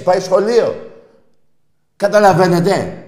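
high, loud climax of a rant; exasperated shout. Real, not acted. Host mocking rival fans' six winless years, exasperated shout. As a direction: shout it to make them grasp it.

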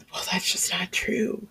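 soft whisper, almost breath. Real, not acted. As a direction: lean in and whisper it. Confiding aside to audience.

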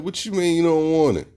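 mock outrage. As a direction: retell your own indignant reaction, hammed-up. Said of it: "I'm like".

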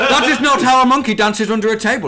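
laughing mock proverb. Friend to podcast mates, laughing mock Dutch proverb. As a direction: spout nonsense wisdom while cracking up.

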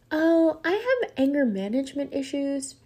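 put-on high, angelic child voice; sweet little girl. Girl confiding in a stranger on a plane, sweet and high. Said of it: "the sweetest, softest voice".

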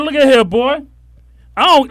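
high, loud stern scolding; shaking with anger. Retold or imitated. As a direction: scold him hard. Retold scolding nephew.

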